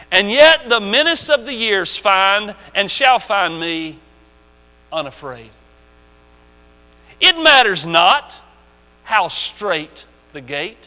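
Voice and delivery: grave, deliberate recital; poem recital. Acted. Continues reading the poem.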